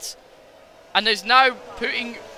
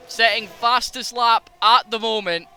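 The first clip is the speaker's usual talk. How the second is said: ordinary talk, nothing marked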